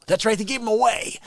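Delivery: ordinary talk, nothing marked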